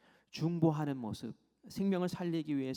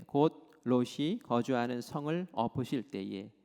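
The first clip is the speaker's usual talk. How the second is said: slow, measured reading aloud of scripture; solemn, drawn-out verse recitation; 2 semitones lower, 5 dB louder than usual. Acted. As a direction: lead a congregation through the verse, deliberately. Reads the verse aloud with congregation.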